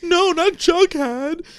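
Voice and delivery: high, slow, loud mock-desperate plea; pleading in fear. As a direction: stretch it out like a hammed-up panicked cry. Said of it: "And she's like".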